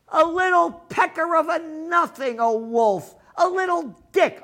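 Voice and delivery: loud, high, jeering insults; sneering yelled mockery; contemptuous rant. Real, not acted. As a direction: shout them down with scorn. Mocking politicians on screen.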